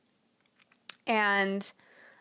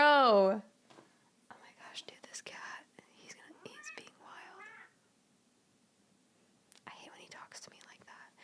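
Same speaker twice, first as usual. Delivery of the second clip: hushed whisper about the wild cat; 9 semitones higher than usual. Real, not acted. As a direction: whisper so the cat stays calm.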